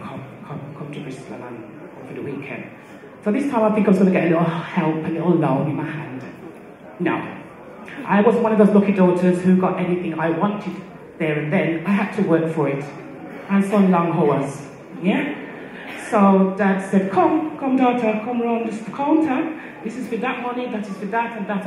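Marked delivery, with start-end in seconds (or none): 0.0-2.6 soft, barely voiced coaxing; hushed father. Father on the phone calming his crying daughter, hushed. 17.1-21.7 a dad showing the ropes kindly; lightly voiced father. Father showing daughter round his shop counter, patient and higher.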